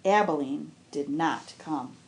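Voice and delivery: ordinary delivery, nothing marked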